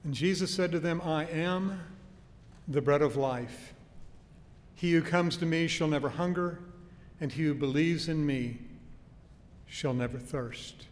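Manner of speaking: loud, projected pulpit reading of a verse; scripture reading. Acted. Preacher reading Jesus' words to congregation, loud proclamation. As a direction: proclaim the verse out to the congregation, pausing between phrases.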